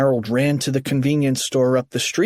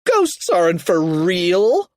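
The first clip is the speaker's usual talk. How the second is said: higher, slower scoffing holler; yell; 6 semitones higher, slower than usual. A narrator's reading. Kipper dismissing the ghost, raised scoffing voice. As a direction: insist with drawn-out disbelief. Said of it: "Kipper yelled".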